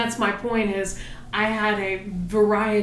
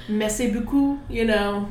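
French phrase with a light French accent. Shown as a demonstration. Showing the partner a more elegant sexy, French accent. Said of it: "a little French".

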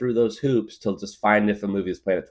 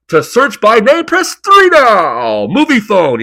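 much higher, much louder, slow booming announcer; movie phone voice; 17 dB louder, much slower than usual. Retold or imitated. Kid doing the movie phone announcer for guests, slow booming. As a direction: boom each menu option like the phone guy. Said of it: "do the movie phone voice".

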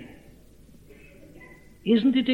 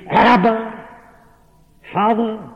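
elemental cry; 5 semitones higher, slower than usual. Acted. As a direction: cry it out from the depths of the soul. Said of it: "produced this elemental cry".

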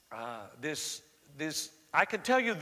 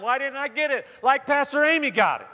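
confused seeker; 8 semitones higher, 8 dB louder than usual. Retold or imitated. Voicing a confused seeker's complaint.

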